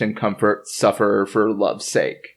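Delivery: ordinary talk, nothing marked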